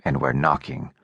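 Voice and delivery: かなり低く抑えた男の声; 喉の奥から低く沈んだ声で; low voice. A narrator's reading. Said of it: "said Low Voice".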